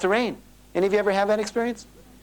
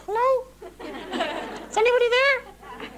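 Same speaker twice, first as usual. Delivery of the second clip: much higher, slow, small voice on the phone; tiny little voice; much slower than usual. Retold or imitated. Caller Joe on the phone, speaking in a tiny, high, slow voice. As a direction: squeak it out like a tiny caller on the line. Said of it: "Then you hear this little voice".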